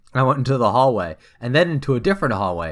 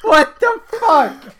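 drawn-out high wail; screaming; 5 dB louder, much slower than usual. Real, not acted. Distracted reader reacting in disbelief, drawn-out screaming. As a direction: wail it slowly and loudly. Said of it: "it's just you're screaming".

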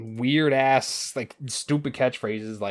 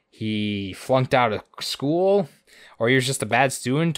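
normal talking voice

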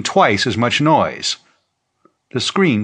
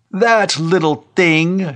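high, slow, drawn-out scorn; scornful cry; 8 semitones higher, 4 dB louder, slower than usual. A narrator's reading. Old preacher dismissing the find, high scornful cry. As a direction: cry it out with contempt. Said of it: "Shanley cried scornfully".

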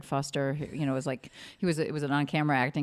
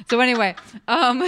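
laughing; 8 semitones higher, 5 dB louder than usual. Real, not acted. Laughing after her odd laugh.

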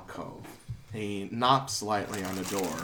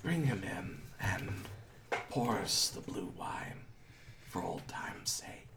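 weak, whispered wheeze from a sickbed; soft, breathy voice of an ailing old man; frail dying master; 3 semitones lower, 9 dB quieter than usual. Acted. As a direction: whisper it low with laboured breath. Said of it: "Darth Vader shit".

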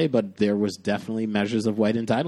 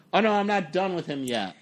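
higher-pitched retold indignation; indignant; 7 semitones higher than usual. Passenger to people walking up to the cab, retold indignant. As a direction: re-enact an indignant protest. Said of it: "in true indignant fashion".

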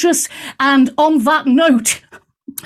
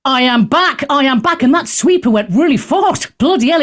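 ordinary talk

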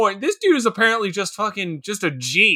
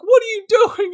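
high, hammed-up bewildered question; mock alarm; 12 semitones higher, 3 dB louder than usual. Onlookers to the crying man, mock alarm. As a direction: pitch it way up in mock disbelief.

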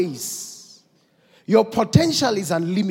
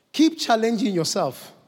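ordinary talk, nothing marked

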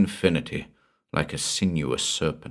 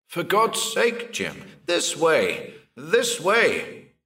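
high, slow pleading call; desperate calling; 10 semitones higher, slower than usual. Acted. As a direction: beg him to come this way. Calling to vanishing friend.

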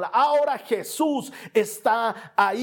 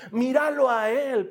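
ordinary delivery

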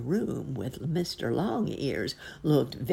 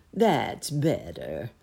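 slow, lower-pitched cat voice; approving cat; 4 semitones lower, much slower than usual. A narrator's reading. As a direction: draw it out low, lightly in character. Cat approves the bunny's answer.

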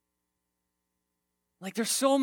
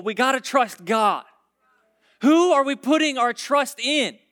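loud, raised sermon voice; forceful preaching; 6 semitones higher, 8 dB louder than usual. Real, not acted. Preacher challenging congregation to trust God, forceful preaching. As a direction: drive the challenge home to the congregation.

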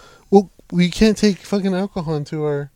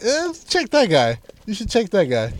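mock HR staff; 7 semitones higher, 3 dB quieter than usual. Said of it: "They're like".

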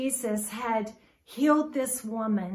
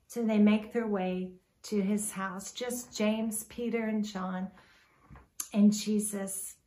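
ordinary talk

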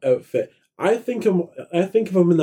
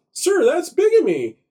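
put-on kid voice; 14 semitones higher than usual. Kid character quote, high voice.

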